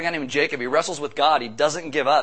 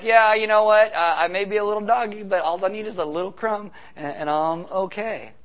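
witty, sassy comeback; 4 semitones higher, 4 dB louder than usual. Retold or imitated. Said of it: "She responds with wit and grace".

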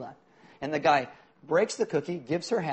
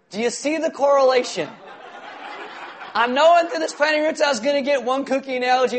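laughing; 6 semitones higher, 7 dB louder than usual. Real, not acted. Laughing through the story's punchline.

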